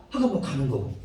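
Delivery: usual speaking voice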